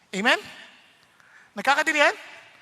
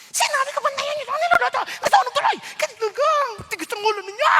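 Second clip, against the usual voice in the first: high, loud mock tirade; loud scolding; 13 semitones higher, 5 dB louder, faster than usual. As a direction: yell shrilly at the household, imitating an angry scolder. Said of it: "ang lakas ng boses". (pasigaw na panggagaya ng nanenermon)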